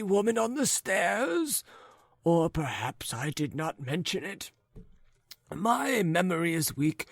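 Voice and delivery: put-on high, wavering old man's voice; chatty old man. Forgetful old man telling his neighbours of a woman, high and doddering.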